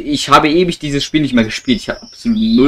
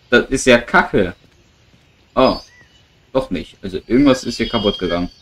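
ordinary talk

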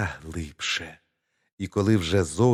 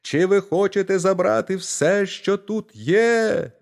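shouting at the top of his lungs; 11 semitones higher, 4 dB louder than usual. Acted. Tiny boy in the pantry yells to the thieves, high and loud. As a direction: yell on purpose so the house wakes. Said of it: "та як закричить на все горло".

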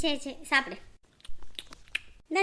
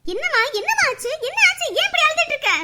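high, loud worried calling out; frantic, pleading to know what happened; father alarmed at his crying daughter; 8 semitones higher, 8 dB louder than usual. Acted.

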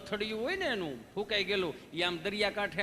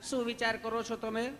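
loving nurse; 3 semitones higher than usual. Retold or imitated. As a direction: ask sweetly like a kind nurse. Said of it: "પ્રેમથી પૂછો છો".

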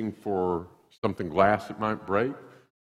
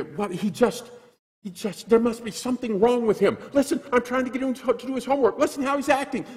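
high-pitched whining mother; mock complaining mother, much higher; whiny mom. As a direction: imitate a fretting mom, pitched way up. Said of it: "she would call me up".

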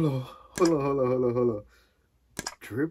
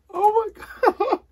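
high, drawn-out squeal of joy; slow, squealed amazement; overjoyed disbelief; slower than usual. Real, not acted. Gamer just made FIFA champion, overjoyed disbelief. Reaches champion rank after grinding.

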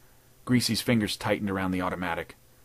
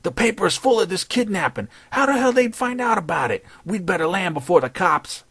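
panicked henchman; 10 semitones higher, 4 dB louder than usual. Acted.

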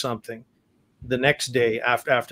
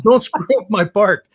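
higher, louder playful scolding; mock stern order; 8 semitones higher, 8 dB louder than usual. [name] mock-scolding the singer over his lyric, loud and high. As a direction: playfully warn him off the lyric. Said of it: "He said".

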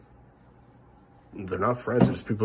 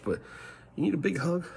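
usual speaking voice

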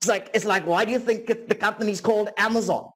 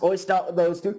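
ordinary talk, nothing marked

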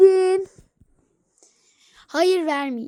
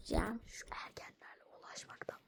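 whispered aside; 9 semitones lower, 26 dB quieter, faster than usual. Acted. Bıkkın fısıltılı kenar yorum.

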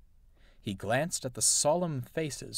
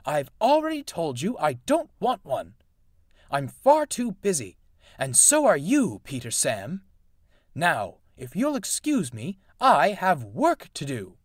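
cross; 5 semitones higher, 5 dB louder than usual. A narrator's reading. Grumpy engine refusing the party.

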